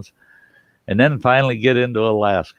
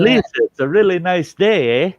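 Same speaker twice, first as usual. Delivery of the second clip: higher, louder mock Canadian accent, ending on eh; Canadian impression; 9 semitones higher, 4 dB louder than usual. Showing Lisa how a Canadian greets her in the morning, higher mock Canadian accent. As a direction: play a chirpy Canadian greeting a neighbour, Canadian accent. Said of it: "if a Canadian walked up to you and said".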